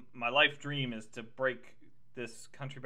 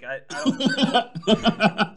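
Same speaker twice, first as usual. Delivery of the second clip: laughing through a quote; 8 semitones higher, 10 dB louder, much slower than usual. Retold or imitated. Imitating Walken, laughing.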